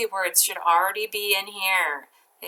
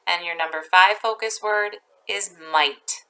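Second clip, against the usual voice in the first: ordinary talk, nothing marked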